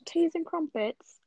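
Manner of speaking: slow, put-on British accent. Shown as a demonstration. Trying out the voice for friends, British accent. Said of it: "Let me do British".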